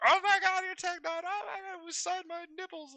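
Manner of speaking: high, loud squealing fangirl; gushing fan. Retold or imitated. To the famous rapper, a hammed-up squealing fan. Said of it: "waiting for me to be like".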